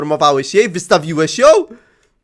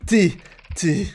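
usual speaking voice

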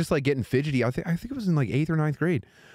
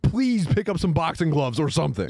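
put-on higher, cocky pep talk to the kids; mock dad voice; 7 semitones higher than usual. Dad telling his kids to toughen up, mock hype. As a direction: hype your kids up like a goofy coach. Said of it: "If I was like".

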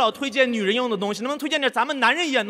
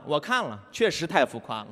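ordinary delivery

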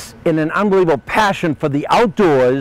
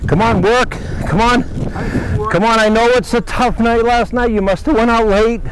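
higher-pitched playful rallying of a lazy crew; mock foreman; 5 semitones higher than usual. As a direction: chivvy them along like a pretend boss. Playfully rousing goats as workers.

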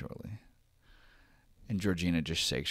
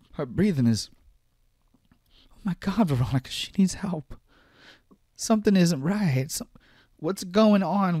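higher and louder, breathless alarm; a mother unravelling as she pleads for help; panicked mother; 11 semitones higher, 8 dB louder than usual. Acted. Mother reports daughter's crisis.

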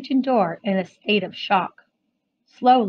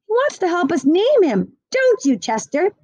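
put-on child voice; 7 semitones higher than usual. A narrator's reading. Child pleading about cat.

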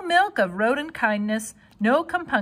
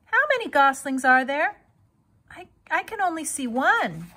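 Wilbur; 6 semitones higher than usual. A narrator's reading.

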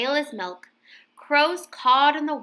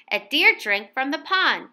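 plain reading voice

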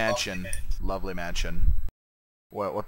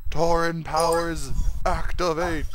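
mock power-up cry; 6 semitones higher, slower than usual. Powering up in game duel.

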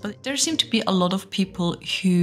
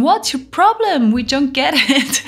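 mocking commenter; 6 semitones higher, 4 dB louder than usual. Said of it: "comment section saying".

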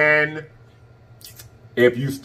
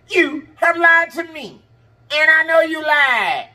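much higher, slow, sassy mock scolding; mock accusation; 14 semitones higher, much slower than usual. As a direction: playfully accuse them, draw it out.

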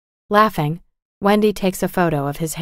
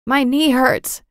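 tearful complaint; 7 semitones higher, slower than usual. A narrator's reading. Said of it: "and begins to cry".

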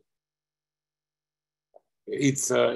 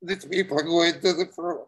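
choked up; 6 semitones higher than usual. Real, not acted. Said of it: "too emotionally difficult to talk about".

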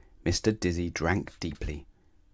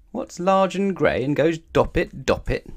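raised, slow questioning; worried; 9 semitones higher, 5 dB louder, slower than usual. A narrator's reading. Elephant repeating Mister Dizzy's riddle, worried and higher. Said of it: "looking worried".

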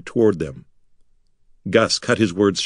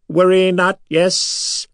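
reassuring creature hiss; 9 semitones higher, much slower than usual. A narrator's reading. Silver Sand leader soothing the frightened prisoners. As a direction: reassure them in a high strange voice. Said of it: "hissed reassuringly".

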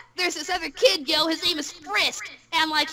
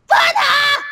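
high, drawn-out shout of "Funha"; calling out; 11 semitones higher, 10 dB louder than usual. Acted. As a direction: holler the name "Funha" to summon them.

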